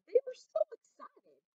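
high, bright excitement; narrating thrilled cows; excited. A narrator's reading. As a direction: lift way up with glee.

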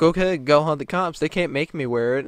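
mocking defiant retort; defiant taunt. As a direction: dare them to call. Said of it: "And then she's like".

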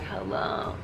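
faint, breathless whisper into the phone; barely voiced, too weak to speak. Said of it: "I didn't have the strength".

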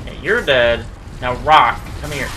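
normal talking voice

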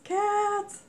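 high, loud cry of joy; excited squeal. Real, not acted. Finding cat stickers in a friend's parcel, high excited squeal. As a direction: burst out delighted at the cats.

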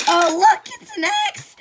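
loud, high squeal of delight; high, gleeful outburst; excited discovery. Pretend. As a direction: squeal at a lucky find. Excited find in a game.

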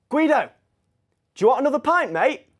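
loud, high call across a room; retold shout. Student heckling the tutor on the phone, loud shout. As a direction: re-enact yelling out to a mate. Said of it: "So I shouted".

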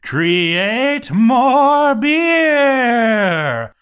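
very slow, high, loud villain decree; dramatic command. Acted. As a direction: bellow it like a dark lord's order.

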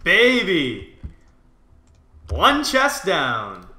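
loud high celebrating shout; excited cheer. Real, not acted. Opens chests, celebrating.

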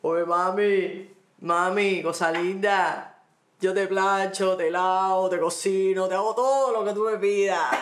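drawn-out, crooning street come-on; super-generous catcaller. Acted. Woman mimics men offering her everything, mock catcall. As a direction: stretch the endearments, then pile up the promises. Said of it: "que son súper bondadosos".

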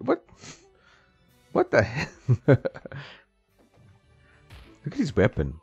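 ordinary talk

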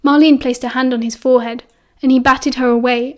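ordinary delivery, nothing marked

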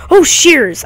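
sudden excited cry; excited exclamation. Real, not acted. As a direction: blurt it out in delight. Spots shears, wants them.

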